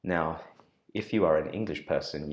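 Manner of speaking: ordinary talk, nothing marked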